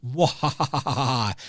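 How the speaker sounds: slow evil laugh; evil villain cackle. Acted.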